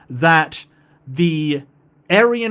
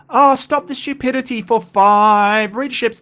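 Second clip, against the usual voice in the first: funny voice; 9 semitones higher, 6 dB louder than usual. Acted. Reads chat in voice.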